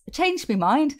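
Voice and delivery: put-on a Cockney accent that slips toward Irish; amateur Cockney accent with an Irish slide; attempted Cockney accent. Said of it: "that was more, more Irish. It was meant to be Cockney".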